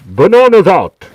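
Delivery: a big, loud yell; playful full-volume shout; shouted on request. Pretend. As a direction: belt it out for the chat. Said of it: "Can you shout bananas out".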